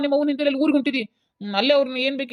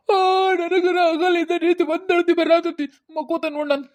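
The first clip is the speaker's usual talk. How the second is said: high, loud, slow mock sob; husband imitating his wife's wailing; 7 semitones higher, 3 dB louder, slower than usual. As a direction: whine like a crybaby to tease her. Husband mocks her crying.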